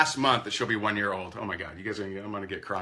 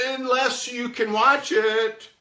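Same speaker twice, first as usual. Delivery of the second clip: mock pleading; 11 semitones higher, 5 dB louder, much slower than usual. Mock desperate pitch.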